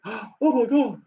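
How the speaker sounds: high, hammed-up gasping oh my God; mock shock. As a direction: play the shocked fool. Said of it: "I would not go".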